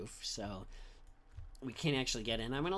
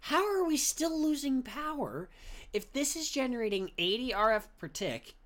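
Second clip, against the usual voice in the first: exasperated; 9 semitones higher, 4 dB louder than usual. Real, not acted. Frustrated at losing power.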